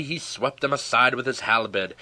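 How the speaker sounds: usual speaking voice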